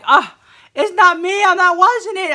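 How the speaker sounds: high, loud, frantic denial; panicked denial. Retold or imitated. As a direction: squeal it out in a panic.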